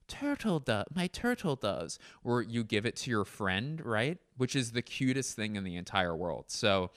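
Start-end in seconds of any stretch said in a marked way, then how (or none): 0.1-2.0 imitated old man. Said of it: "That adorable old man gave the kid".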